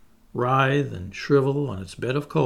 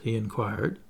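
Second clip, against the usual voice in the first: ordinary delivery, nothing marked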